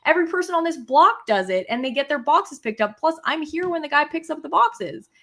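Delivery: firing back that she's not wrong; retold yelling. Wife replaying argument with husband, yelling she's not wrong. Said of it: "you still shouldn't have yelled".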